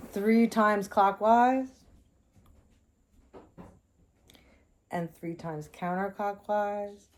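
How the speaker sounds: slow, spaced-out meditative instruction; guided ritual. Shown as a demonstration. Leading viewers through a crystal blessing, slow meditative voice. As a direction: guide them calmly, with long pauses.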